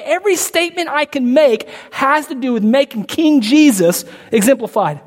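high, loud, driving sermon climax; full-force preaching. Acted. Pastor to congregation, preaching at full force. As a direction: preach it out with rising fervor.

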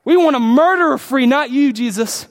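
high, loud jeering crowd cry; crowd shouting. Retold or imitated. Preacher voicing the crowd rejecting Jesus, loud high shout. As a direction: bellow it like a mob demanding a prisoner.

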